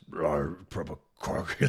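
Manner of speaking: very slow croaky rasp; mock smoker's croak, dragged out. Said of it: "she sounded like a smoker".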